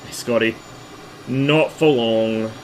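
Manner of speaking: usual speaking voice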